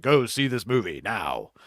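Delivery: lightly voiced movie trailer announcer; a hint of the voice of God; mild trailer-guy command. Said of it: "that guy doing the voices in the movie trailers".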